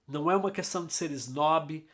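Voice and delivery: usual speaking voice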